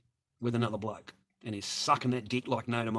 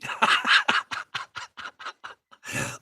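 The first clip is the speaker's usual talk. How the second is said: loud breathy cackle; wheezing cackle; big laugh; 9 semitones higher, 5 dB louder than usual. Real, not acted. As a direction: burst out laughing.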